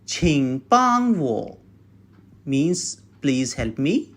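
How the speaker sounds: normal talking voice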